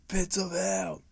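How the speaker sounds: hammed-up eerie echo. Storyteller echoing his own spooky phrase, in a high whispery voice. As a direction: repeat it in a high, airy mock-spooky voice.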